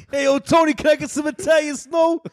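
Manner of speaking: high, shouted Italian-American caricature; mock Italian-American accent. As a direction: holler it like a cartoon Italian-American. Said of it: "you sound like you're from Boston".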